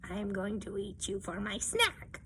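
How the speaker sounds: quiet lurking troll voice; troll cat threat. Acted.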